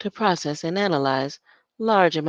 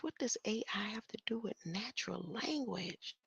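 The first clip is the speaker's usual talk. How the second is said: whisper; 2 semitones higher, 14 dB quieter than usual. Real, not acted. Reading to self, whispered.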